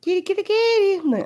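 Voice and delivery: high quick kitty call; calling a cat. Real, not acted. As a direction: call her up high and fast.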